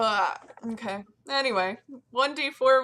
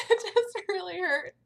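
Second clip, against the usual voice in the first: laughing it off, much higher; 11 semitones higher than usual. Real, not acted. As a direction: giggle through the words. After a painful sneeze.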